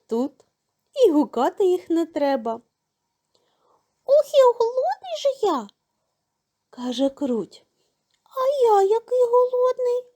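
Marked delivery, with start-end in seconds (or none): 4.1-5.7 high squeaky mouse, eager; hungry mouse. A narrator's reading. Mouse tells the rooster he is hungry, high squeak. 8.2-10.0 high squeaky mouse, eager; hungry mouse. A narrator's reading. Mouse tells the rooster he is hungry, high squeak.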